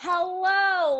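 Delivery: loud, high exaggerated hello; overly loud greeting to a foreigner; mock loud hello. Imitating customers talking down to nail staff, a loud high hello. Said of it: "they talk louder as if".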